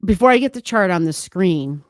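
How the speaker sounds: normal talking voice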